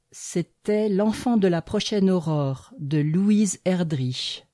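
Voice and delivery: plain reading voice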